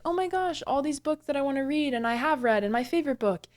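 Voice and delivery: high, gushing book-lover squeal; imitating her own thrilled gasp at the shelf; retold excitement. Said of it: "more excitement than I'd seen".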